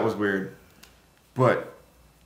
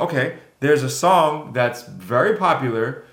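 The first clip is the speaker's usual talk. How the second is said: normal talking voice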